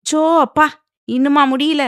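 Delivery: high, loud burst of exasperation; exasperated exclamation. A narrator's reading. Sanvi walking in on a squabble, loudly exasperated. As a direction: break in loudly, fed up with the bickering.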